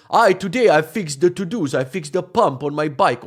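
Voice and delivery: loud, hammed-up Slavic accent. Shown as a demonstration. Comic showing how horrible it sounds, hammed-up Slavic accent. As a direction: demonstrate a clumsy Slavic accent. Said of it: "Slavic type of accent and you sound like".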